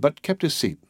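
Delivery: plain reading voice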